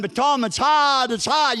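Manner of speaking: high, drawn-out imitation complaint; mock whining. People moaning to Tom about life, mock whining. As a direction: whine it slowly, mocking the complainers.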